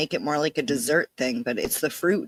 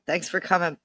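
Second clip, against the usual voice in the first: ordinary delivery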